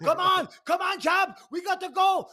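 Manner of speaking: very high, loud urging shouts; acted shouting cornermen. Team in the sauna driving the champ on, high frantic shouting. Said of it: "they're all in the sauna right now going".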